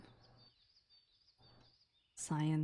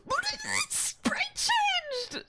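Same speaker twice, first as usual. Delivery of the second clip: gasp, then very high, stretched squeal; real thrilled fangirl shriek; overexcited; much slower than usual. Real, not acted. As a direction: squeal it slowly at the very top of the voice. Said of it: "I'm sorry, I'm so excited".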